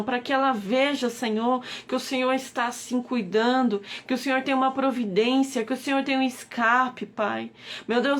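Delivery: ordinary delivery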